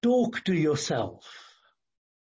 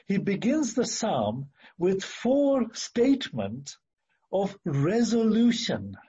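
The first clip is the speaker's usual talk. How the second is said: normal talking voice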